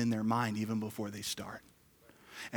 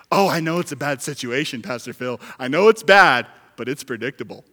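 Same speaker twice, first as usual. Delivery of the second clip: excusing churchgoer; 12 dB louder than usual. Retold or imitated. Congregant answering Pastor Phil.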